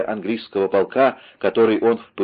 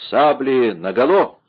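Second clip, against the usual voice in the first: drawn-out, higher battle order; shouted command; 4 semitones higher, 4 dB louder than usual. A narrator's reading. Winter orders his men to draw sabres, shouted command. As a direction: call it out long and rising. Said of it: "раздалась команда Винтера".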